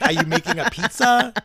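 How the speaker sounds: high-pitched mock Italian accent. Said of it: "doing an Italian accent".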